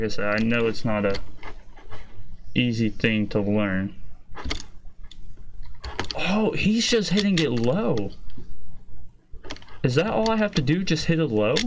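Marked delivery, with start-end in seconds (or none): 6.2-11.5 high, sudden realisation; startled discovery; surprised. Real, not acted. Realises the trick watching.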